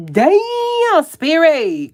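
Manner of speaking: high, loud burst of amazement; excited exclaim. Real, not acted. Reacting to a tarot card she pulled, excited cry. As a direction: cry out as the card lands just right.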